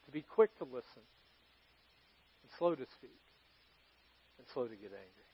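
hushed, reverent recitation trailing away; soft recitation. Shown as a demonstration. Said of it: "I've learned".

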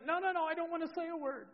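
high-pitched mock wail; hammed-up pleading refusal; distraught child. Daughter refusing to say the word, imitated tearful protest. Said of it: "and she's crying".

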